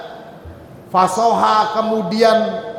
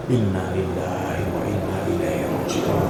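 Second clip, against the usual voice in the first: solemn istirja'; 12 semitones lower, 7 dB quieter than usual. Acted. Prophet reciting istirja' on death.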